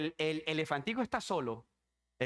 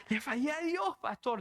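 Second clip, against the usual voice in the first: much higher, pleading mock sob; imitated distraught crying; 8 semitones higher than usual. Caller cannot explain herself to pastor, imitated distraught cry. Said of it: "no podía decirme".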